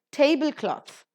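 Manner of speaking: tablecloth with TABLE high and loud; tablecloth, high start and falling end; stress on first word. Shown as a demonstration. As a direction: hit the start of tablecloth, slowly. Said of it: "take the stress on the first syllable".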